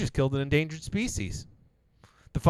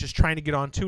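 ordinary talk, nothing marked

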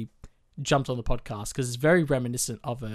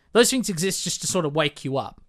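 normal talking voice

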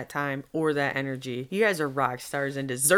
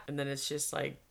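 ordinary talk, nothing marked

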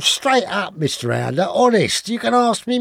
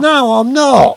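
loud protest; 5 semitones higher, 7 dB louder, slower than usual. Acted. Suspect protesting under hoover attack.

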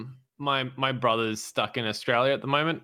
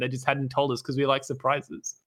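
ordinary delivery

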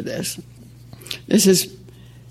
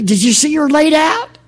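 excited question; 10 semitones higher, 5 dB louder than usual. Acted. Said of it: "she asked excitedly".

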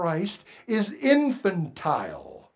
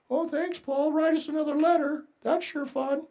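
sarcastic; 7 semitones higher, 6 dB quieter than usual. Pretend. Sarcastic reply of the church.

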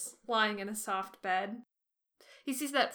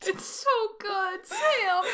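much higher, slow crying-out delight; overcome with feeling; 13 semitones higher, 6 dB louder, slower than usual. Real, not acted. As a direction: gush over the moment, voice cracking. Emotional reaction to the scene.

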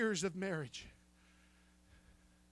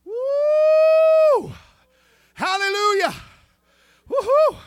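high, loud ecstatic whoop; joyful praise cry; 13 dB louder, slower than usual. Real, not acted. Preacher whooping for joy at the psalm, ecstatic shout.

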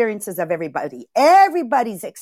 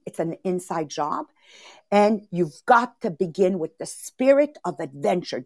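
ordinary talk

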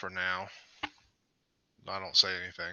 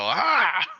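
short high-pitched yell, louder; mock yell; 9 semitones higher, 5 dB louder than usual. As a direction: let out a sudden high "Ah!" as a pretend yell. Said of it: "I yell".

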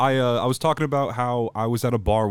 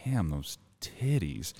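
low murmur; 5 semitones lower, 8 dB quieter, much slower than usual. Retold or imitated. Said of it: "I said into her chest out loud".

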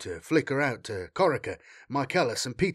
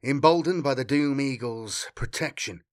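plain reading voice

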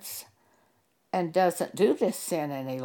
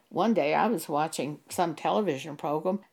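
ordinary delivery

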